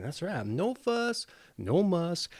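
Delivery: usual speaking voice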